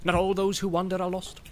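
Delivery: put-on old hobbit stands up and recites for his friend; old hobbit bursting out. A narrator's reading. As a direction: declaim the rhyme as if defending a friend. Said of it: "he burst out".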